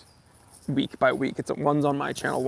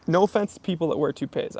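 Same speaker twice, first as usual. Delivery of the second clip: ordinary delivery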